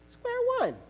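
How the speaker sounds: very high-pitched, drawn after a pause; exasperated punchline. Acted. Daddy telling child about starting over, exasperated. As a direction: leap up high on the words.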